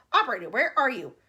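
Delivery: loud call into the receiver; calling into the phone. Acted. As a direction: shout for the operator. President yelling into phone.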